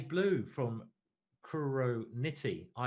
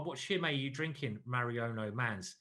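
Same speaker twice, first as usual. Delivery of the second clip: usual speaking voice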